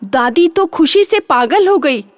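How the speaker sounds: plain reading voice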